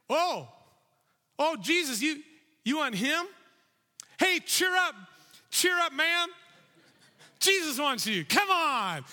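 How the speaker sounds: suddenly buddy-buddy, overdone; fake cheery crowd. Crowd turns fake friendly.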